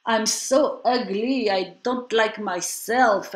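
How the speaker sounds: slow, mock-sorrowful Portuguese accent. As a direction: lightly imitate a Portuguese man lamenting, slowly. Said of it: "he has a very strong Portuguese accent".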